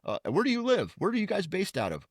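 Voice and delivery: teasing a phone scammer in a high goofy voice. Retold or imitated. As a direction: pester him in a mock dopey voice. Mocking scammer with goofy questions.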